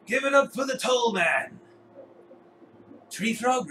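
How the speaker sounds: loud, high ring-announcer shout; arena announcer presenting a champion; fight commentator. Acted. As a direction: hype up the crowd for the winner. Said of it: "the commentator walks over to you".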